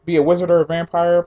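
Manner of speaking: ordinary talk, nothing marked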